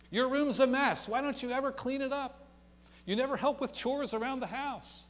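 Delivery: high, loud nagging mom; mother berating her teen about her messy room; scolding mother. Acted. Mother scolding teenage daughter.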